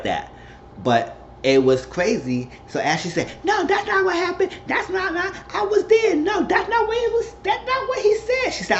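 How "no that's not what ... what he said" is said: very high mock protest; indignant denial. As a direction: imitate a wife insisting it never happened, pitched way up. Acts out Ashley's heated denial.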